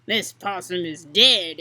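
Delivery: gruff snorting creature; snorted. Acted. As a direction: grunt it out like a boar. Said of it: "it snorted".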